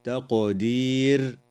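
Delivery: the Q in تقدير given a slight echoing bounce; تقدير with a bouncing qaf; slow, crisp تقدير; qalqala on Q. Shown as a demonstration. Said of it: "تقلقل القاف".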